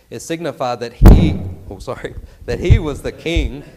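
a sudden loud burst of preaching fire; fired-up preaching. Real, not acted. Preacher caught up in his sermon, a loud fired-up burst. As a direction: let the passion break out loud. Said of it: "I'm getting fired up".

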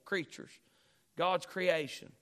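ordinary delivery

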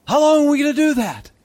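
high, loud pleading cry; impassioned cry. Real, not acted. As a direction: cry it out to the church. Preacher cries out to congregation.

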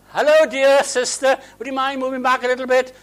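high, sugary posh lady voice, imitating; mock polite lady. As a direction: mimic an overly sweet lady driver. Mock polite exchange between drivers.

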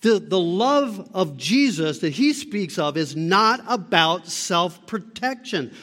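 ordinary delivery

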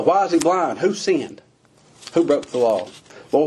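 ordinary talk

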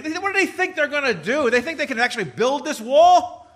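loud high scornful mockery; hammed-up mocking taunt; mocking scoffers. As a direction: sneer it like the wall's enemies. Mocking enemies voiced in sermon.

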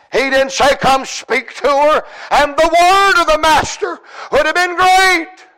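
sudden high surge of pulpit fervour; full-force preaching. Real, not acted. Preacher exalting the word of the master, high fervent preaching. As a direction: lift the phrase high and ring it out.

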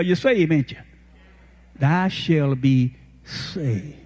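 usual speaking voice

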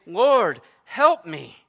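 loud, high, imploring cry. Acted. Woman falling down before Jesus, crying out loudly. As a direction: call out for help at full voice. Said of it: "just continuing to cry out".

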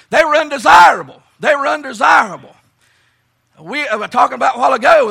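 hammered, forceful list of labels; preaching. Real, not acted. Preacher telling camp meeting they are misfits, preaching. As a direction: drive each phrase home from the pulpit.

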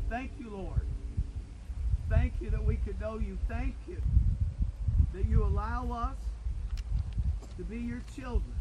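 loud, fervent thanksgiving; fervent loud prayer. Real, not acted. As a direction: lift the voice in praise.

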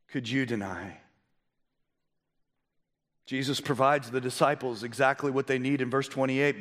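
usual speaking voice

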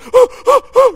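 loud, high imitated gasp of shock. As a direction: gasp like a startled grandmother.

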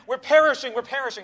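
frantic disciples shouting in the storm; panic. Acted.

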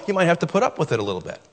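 ordinary delivery

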